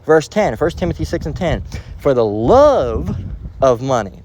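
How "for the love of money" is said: slow, drawn-out love, leaned on; love stretched and stressed. Shown as a demonstration. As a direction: lean hard on the word love. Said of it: "the love of money, not money".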